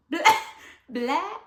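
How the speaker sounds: high loud laughing echo; giggling incredulous echo; laughing disbelief. Retold or imitated. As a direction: laugh at the absurdity.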